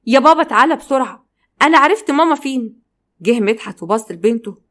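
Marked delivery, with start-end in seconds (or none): 0.1-2.8 higher, raised call to Dad. A narrator's reading. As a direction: call urgently for your father to come. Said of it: "رهف قعدت تنادى على باباها". (بتنادي على باباها بصوت عالي)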